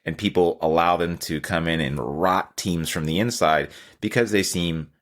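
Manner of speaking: usual speaking voice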